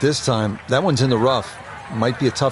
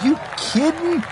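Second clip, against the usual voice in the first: amazed; 12 semitones higher than usual. Real, not acted. Commentator reacts to great shot.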